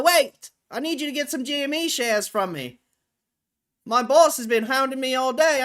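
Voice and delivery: high, loud street newsboy pitch. Pretend. Newsboy begging a boss to buy shares, high insistent shout. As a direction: holler like a newsboy hawking papers. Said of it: "Just so I can talk in that voice".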